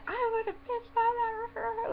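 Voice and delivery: high-pitched exaggerated outrage; hammed-up squeal of indignation; mock outburst. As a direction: imitate someone going off, way up high. Imitating people who would go off.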